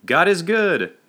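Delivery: high, loud call; shouted. A narrator's reading. Pastor calling to his congregation, shouted. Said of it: "then shouted".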